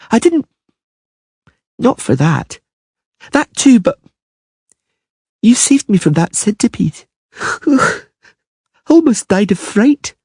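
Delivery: put-on high, earnest young girl; a shy child saying thanks; little girl voice. A narrator's reading. Ciri thanking shyly.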